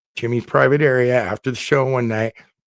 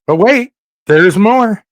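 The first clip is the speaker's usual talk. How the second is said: high, loud teaser with a long WAIT; slow, punched-up catchphrase; mock infomercial pitch; 8 semitones higher, 6 dB louder, slower than usual. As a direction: sell it like a TV pitchman.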